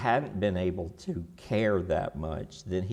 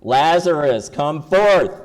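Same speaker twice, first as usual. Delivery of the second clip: loud command; 4 semitones higher, 11 dB louder, slower than usual. Shown as a demonstration. Jesus commanding the dead Lazarus, loud shout. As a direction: call it out loud and strong. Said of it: "let's all do this again".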